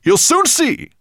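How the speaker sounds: high, loud snorted boast; happy snort. Acted. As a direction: snort it out, pleased. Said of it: "snorted happily".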